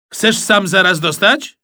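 put-on boy squaring up for a fight, loud taunt; cocky threat. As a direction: snap back a dare at him. Boys squabble, threat back.

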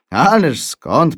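lekko rozbawiony tata, głośno i przeciągle; przeciągnij wyżej, z nutą rozbawienia; ze śmiechem. A narrator's reading. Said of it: "powiedział tata ze śmiechem". (po polsku: z lekkim rozbawieniem)

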